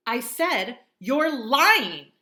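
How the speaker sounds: loud high angry shout. Acted. Augie shouts at Mom that she is lying. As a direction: yell the accusation. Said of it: "I shouted".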